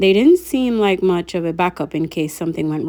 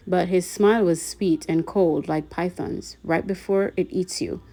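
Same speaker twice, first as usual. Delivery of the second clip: usual speaking voice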